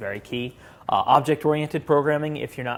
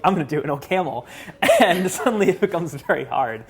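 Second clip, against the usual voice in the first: higher-pitched, talking through a laugh; amused laughing; 5 semitones higher, 4 dB louder than usual. Real, not acted. Lecturer joking about the interview going wrong, laughing. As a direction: let the laugh break into the words.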